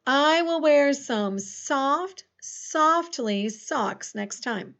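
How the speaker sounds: slow, drawn-out dictation pace. Shown as a demonstration. Teacher dictating a sentence slowly to students. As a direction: read each word slowly for spelling. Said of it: "Now, we're not describing a verb".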